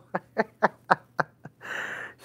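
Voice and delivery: high, breathless real laugh; helpless laughter at the memory. Real, not acted. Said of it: "She really made me laugh".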